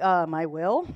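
low, understated reply, voicing God; deadpan answer. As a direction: drop low and answer matter-of-factly. God's short answer to Jonah.

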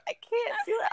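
high, crying "can't do it"; tearful mock wail. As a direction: pretend to break down in happy tears. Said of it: "I'm like".

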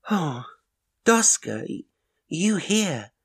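put-on high, bright little-girl voice, surprised; a sleepy child startled awake; child exclaiming. A narrator's reading. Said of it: "the child exclaimed innocently".

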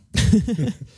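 short burst of surprised laughter; a quick, high, startled laugh; shocked laughter. Real, not acted. Reacts to "I'm crying right now.

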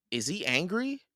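high-pitched, uneasy question; wondering. A narrator's reading. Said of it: "Jack wondered".

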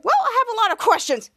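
high, loud retort; mock defensive protest. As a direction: act out indignant insistence. Acted protest at being interrupted.

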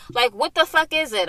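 ordinary delivery

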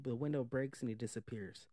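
usual speaking voice

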